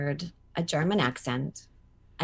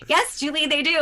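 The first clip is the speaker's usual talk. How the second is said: humoring agent; 11 semitones higher, 5 dB louder than usual. Retold or imitated. Voicing her laughing agents' reply.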